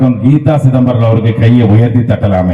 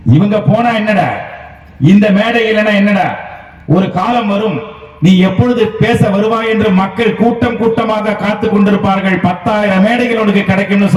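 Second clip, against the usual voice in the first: higher, rousing retold promise; mother's proud prophecy; 7 semitones higher than usual. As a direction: lift it like a mother's proud vow. Mother foretelling son's success. (அம்மாவின் பெருமிதமான உற்சாகக் குரல்)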